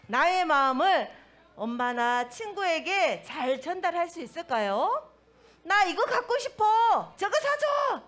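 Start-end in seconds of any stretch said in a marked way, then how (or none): 5.6-8.0 loud, high-pitched mock tantrum; acted angry outburst, pitched high; angry child's demand. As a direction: demand it like a cross child, voice shooting up. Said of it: "이렇게 큰 소리로 화내면서".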